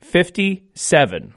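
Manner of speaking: slow, deliberate number calling; bingo caller. Acted. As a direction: call each ball slowly with falling ends. Calls each bingo ball twice.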